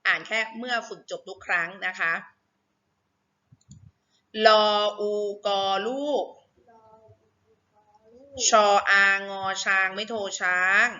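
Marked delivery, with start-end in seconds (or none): 4.5-10.9 spelling drill. Shown as a demonstration. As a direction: sound out each syllable like a reading teacher. Leads a reading drill.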